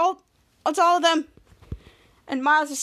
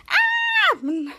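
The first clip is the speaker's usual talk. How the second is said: long, very high mock wail; drawn-out cartoon scream; acted scream. Tiny character hurt by spicy milk, mock screaming.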